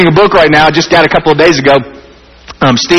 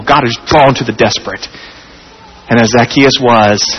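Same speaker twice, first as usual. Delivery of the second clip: tender appeal; 6 semitones lower than usual. Acted. Preacher softens into altar appeal.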